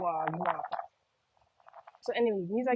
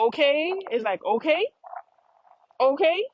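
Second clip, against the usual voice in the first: loud okay with a high rising end; emphatic confirming okay; 6 semitones higher, 8 dB louder than usual. Shown as a demonstration. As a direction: punch OKAY and lift the last syllable sharply. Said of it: "I'm not really asking you".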